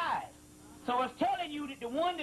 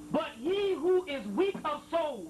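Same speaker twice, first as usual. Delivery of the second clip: higher, slow, solemn intoning; scripture reading; 4 semitones higher, 3 dB louder, slower than usual. Acted. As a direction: draw out each word like prophecy. Reading a sacred passage aloud.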